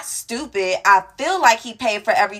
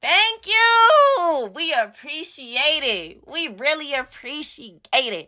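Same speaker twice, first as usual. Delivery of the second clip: exaggerated gratitude; 4 semitones higher, slower than usual. Thanking the man who paid, hammed-up gushing. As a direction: gush with thanks, stretching the words.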